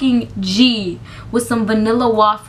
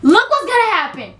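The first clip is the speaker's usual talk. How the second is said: cousin giving orders; 8 semitones higher than usual. Retold or imitated. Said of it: "She said".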